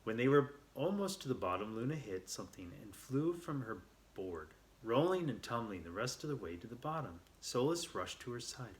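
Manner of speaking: ordinary delivery, nothing marked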